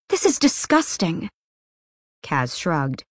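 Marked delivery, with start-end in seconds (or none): 0.1-1.3 disgusted. Acted. Nina appalled at arena killing.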